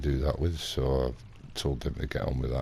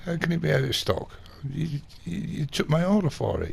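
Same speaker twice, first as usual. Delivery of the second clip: high-pitched, stammering retold objection; indignant protest; 13 semitones higher than usual. Customer disputes the out-of-stock claim, indignant. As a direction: argue back in mock outrage. Acted protest over cancelled order.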